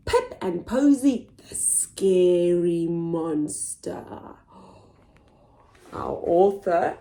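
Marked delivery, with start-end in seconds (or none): none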